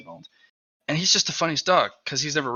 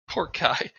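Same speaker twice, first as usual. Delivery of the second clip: ordinary talk, nothing marked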